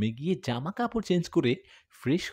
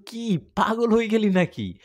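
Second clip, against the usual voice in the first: put-on higher, shocked girl's exclamation; astonished girlfriend scolding on phone; startled; 7 semitones higher, 4 dB louder than usual. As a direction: she can't believe he'd come at night. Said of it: "চমকে গিয়ে বলল".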